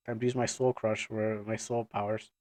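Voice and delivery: low, soft character voice; determined Ayer. Acted. Ayer readying soul powers, low and resolved. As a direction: keep it low and quietly resolved.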